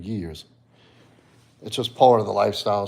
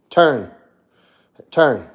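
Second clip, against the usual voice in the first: dog command; 3 semitones higher, 8 dB louder than usual. Real, not acted.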